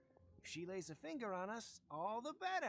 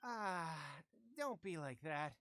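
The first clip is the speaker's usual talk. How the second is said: ordinary talk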